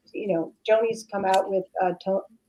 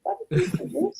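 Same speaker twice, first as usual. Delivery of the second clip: mock bewildered question, soft and high; baffled parents; 11 semitones higher, 5 dB quieter than usual. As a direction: imitate puzzled parents, pitch lifting like a dog's cocked head. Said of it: "they just kind of was like".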